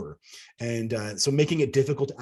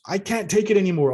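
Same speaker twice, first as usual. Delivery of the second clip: higher, louder desperate blurt; raw emotional outburst; 8 semitones higher, 5 dB louder than usual. Retold or imitated. Abductee pleads with the elder, raw and desperate. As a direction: blurt it out, at the end of your rope. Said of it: "I blurted out with a raw emotions".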